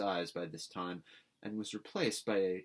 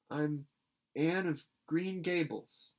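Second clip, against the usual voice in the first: slightly higher, slow, timid; tremulous; 7 semitones higher, 4 dB louder, slower than usual. A narrator's reading. Anne introducing herself to Miss Barry, tremulous. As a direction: say it nervously. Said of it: "said the small visitor tremulously".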